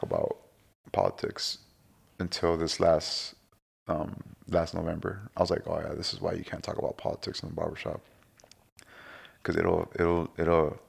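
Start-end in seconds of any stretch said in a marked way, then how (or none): 0.0-1.6 hushed whisper, voice dropped. Shown as a demonstration. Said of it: "you gotta say it real low". 5.7-8.0 hushed, under the breath; whispering. Shown as a demonstration. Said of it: "you gotta say it real low".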